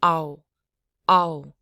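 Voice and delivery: loud "ow", a and u in one quick glide; quick vowel blend. Shown as a demonstration. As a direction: blend a into u for a short "ow". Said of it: "a real quick blend of a and u".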